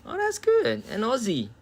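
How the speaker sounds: very high, loud burst of delight. Real, not acted. Streamer finds an Aussie in the chat, high-pitched delight. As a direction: squeal it, thrilled by the news.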